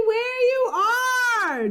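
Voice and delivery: high, slow, drawn-out shout. A narrator's reading. Jack shouting to Annie to stay, drawn-out shout. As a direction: hold the shout to stop her. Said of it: "Jack shouted".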